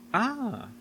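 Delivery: sudden high ah of recognition; the penny drops; knowing ah. Acted. Realizes who is in driveway.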